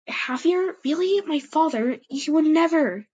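high, loud disbelief; shocked exclaim. A narrator's reading. Silverpaw hearing bad news about her father, high and loud. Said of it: "she exclaimed".

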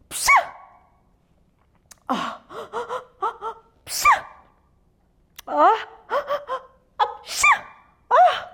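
big lion sneezes with loud gasps; hammed-up repeated sneezing; acted sneezes. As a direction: build up, then sneeze explosively. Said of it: "hapşırıklarını duydular".

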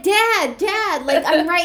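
high, loud mock call to dad. Imagining calling to dad driving past, mock yell. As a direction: yell it after a passing car, half-joking. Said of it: "I should have just been like".

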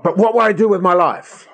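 high mock wail; hammed-up impatient pleading; exasperated plea. Believer ignoring God's tree, exasperated mock plea. Acted return to obsessive question.

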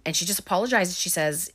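ordinary talk

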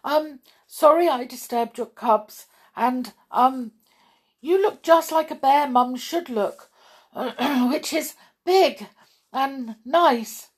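nervous little rabbit sucking up; nervous apology. Acted. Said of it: "Thumper tried again".

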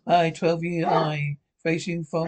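ordinary talk, nothing marked

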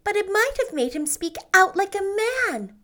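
mild urging. A narrator's reading. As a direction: push her gently to act. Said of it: "urged Anne".

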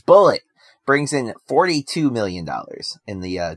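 ordinary talk

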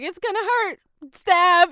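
high, loud mock nurse barking the jab; blunt nurse. The nurse about to inject her, a high, loud blunt mock bark. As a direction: play the nurse as brusque and comic.

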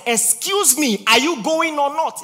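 high, loud mock demand; impatient passenger demanding the driver go, loud; impatient shout. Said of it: "I said".

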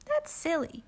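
very high small voice; small child voice. Acted. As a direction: answer like a sulky toddler. Pookie answers.

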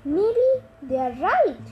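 plain reading voice

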